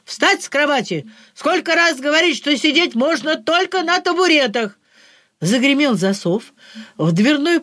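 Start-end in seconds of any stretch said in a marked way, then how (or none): 0.0-4.8 fake yell. A narrator's reading. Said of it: "заорал".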